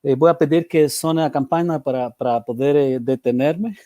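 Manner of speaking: Portuguese with a heavy Spanish accent; Spanish speaker mixing into Portuguese; portunhol. Real, not acted.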